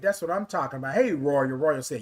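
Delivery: ordinary talk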